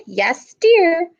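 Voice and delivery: high scratchy sick granny. Acted. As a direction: rasp it out like a granny with a cold. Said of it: "came a scratchy voice".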